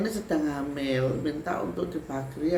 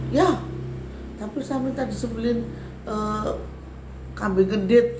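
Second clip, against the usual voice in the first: high, loud bargaining spirit; 7 semitones higher, 4 dB louder than usual. Retold or imitated. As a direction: demand it like a spirit. Spirit demands a goat sacrifice.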